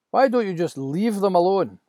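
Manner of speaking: high, loud confrontation; angry challenge. Acted. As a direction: Moses squaring up to the shepherds. Moses confronting shepherds.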